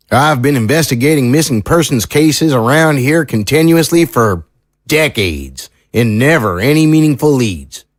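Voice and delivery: folksy small-town sheriff, earnest. Acted. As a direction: play a seasoned sheriff weighing strange testimony. Sheriff figure in audio drama.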